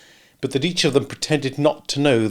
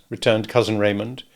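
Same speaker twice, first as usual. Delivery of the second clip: usual speaking voice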